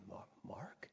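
whispered, hesitant question; tentative whisper; hushed, puzzled mother. Retold or imitated. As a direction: whisper it like an unsure, sleepy mom. Sleepy mother surprised to see him.

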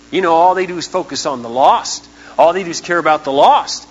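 scornful imitation of church critics; mocking critics. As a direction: mimic the grumblers looking down on us. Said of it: "We have people muttering about us".

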